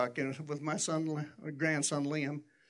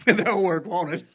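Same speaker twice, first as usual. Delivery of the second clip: laughing; 2 semitones higher, 8 dB louder, slower than usual. Real, not acted. Joking about bullet sticker.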